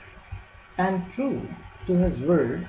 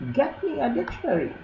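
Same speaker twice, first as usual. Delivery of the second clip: high, fast, squeaky demand; thin reedy voice; 9 semitones higher, faster than usual. Acted. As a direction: squeak it like a rat. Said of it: "he would squeak".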